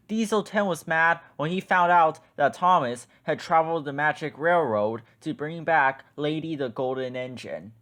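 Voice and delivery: plain reading voice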